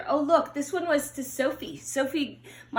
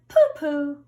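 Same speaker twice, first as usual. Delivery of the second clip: put-on a light scoffing little girl; lightly voiced brave girl; dismissive pooh; 3 semitones higher, 7 dB louder than usual. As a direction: brush off the tiger with a louder pooh. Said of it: "Madeline just said".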